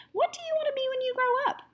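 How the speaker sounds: very high bright teacher voice; sing-song teacher. Acted. Teacher asking her class in a high sing-song. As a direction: ask it like a chirpy teacher.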